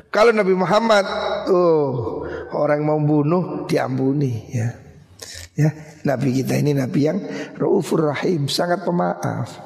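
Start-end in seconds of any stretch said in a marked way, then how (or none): none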